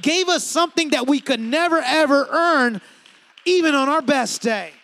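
loud, driving sermon delivery; full-force preaching. Real, not acted. Preacher to congregation on God's undeserved love, full-force preaching. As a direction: preach it out with big emphasis.

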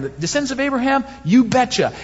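high, cocky bragging over each question; smug rival topping the false teachers; boastful one-upping. Retold or imitated. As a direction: toss off each answer like a trump card. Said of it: "he's gonna say".

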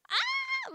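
very high excited squeal; stoked squeal. Retold or imitated. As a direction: let out a thrilled, high-pitched shriek. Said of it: "like, so stoked on life".

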